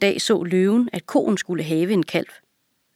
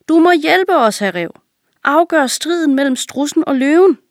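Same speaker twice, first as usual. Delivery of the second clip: urgent raised-voice plea; calling out; 8 semitones higher, 4 dB louder than usual. A narrator's reading. All the animals appealing to the passing fox, calling out. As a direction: call out higher and louder for help. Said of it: "Alle dyrene råbte".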